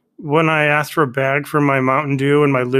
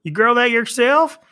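Carol Baskin cashier; 2 semitones higher than usual. Pretend. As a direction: coo at him like a sleazy clerk. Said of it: "I'll be you as Carol Baskins as the cashier".

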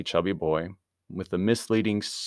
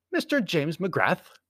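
high, slow clerk's voice; desk clerk; 10 semitones higher, 3 dB louder, slower than usual. A narrator's reading. Clerk on the telephone to a guest, high role voice. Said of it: "The voice of the desk clerk".

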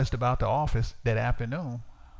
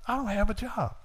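higher-pitched plea to the Lord; praying complaint; 7 semitones higher than usual. Retold or imitated. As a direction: retell a plaintive prayer, pitched up. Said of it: "I'm just praying".

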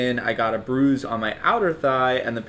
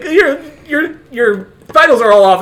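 high, loud hammed-up alarm with laughter; alarmed nurse; 11 semitones higher, 10 dB louder than usual. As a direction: play the nurse in a mock panic. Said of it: "she's like".

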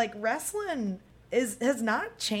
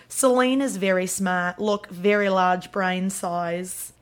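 Australian drama-teacher impression; drawn-out Australian vowels as a TV character; Australian accent; 3 semitones lower, 3 dB louder, slower than usual. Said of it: "I wanted to do an Australian accent".

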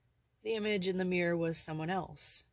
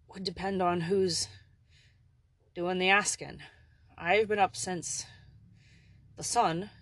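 put-on gravelly old Irish servant, polite and kindly; lightly voiced old Irishman; 2 semitones higher, 6 dB louder than usual. A narrator's reading. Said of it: "his odd, gravelly voice".

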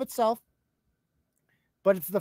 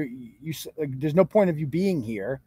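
ordinary talk, nothing marked

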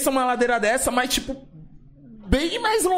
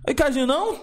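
high mock chill voice; 9 semitones higher than usual. Voicing friend's relaxed reply.

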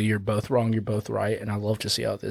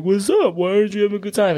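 higher, quick cartoon shark; shark character voice; 7 semitones higher, faster than usual. Shark asking the sad monkey what is wrong, high character voice. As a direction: voice a chummy shark, pitched up. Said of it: "the shark's like".

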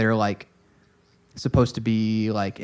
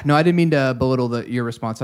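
ordinary delivery

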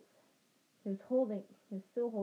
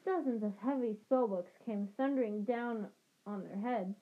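ordinary delivery, nothing marked

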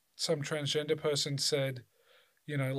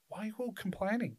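high, softer incredulous complaint; mock exasperation; 7 semitones higher, 6 dB quieter than usual. Voicing someone fed up with friends over wine, high mock exasperation. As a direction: act out being fed up with whingers.

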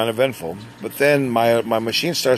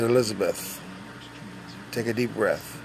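calm reassurance; 2 semitones lower, 9 dB quieter than usual. Retold or imitated. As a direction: settle a nervous helper quietly. Calming a scared new tech.

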